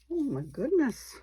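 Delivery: happy gasp of surprise at a lucky find; surprised. Real, not acted. Finds a lucky flower.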